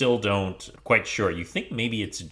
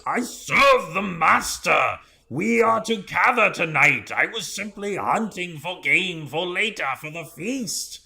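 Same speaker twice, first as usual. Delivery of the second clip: bugbear's shouted protest; 10 semitones higher than usual. Acted. Bugbear explains himself to Zuana, high shouted protest. Fallen bugbear pleads in character.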